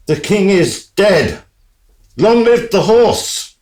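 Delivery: high, loud cry of triumph; triumphant proclamation. Acted. Horse crowing over the drowned king, triumphant cry. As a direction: proclaim it to the whole kingdom.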